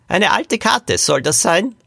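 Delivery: put-on high-pitched old man's sceptical question; questioning great-grandfather. A narrator's reading. Great-grandfather asks the four about the map, high questioning voice. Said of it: "fragte der Urgroßvater die vier".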